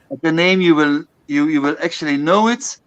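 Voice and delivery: ordinary talk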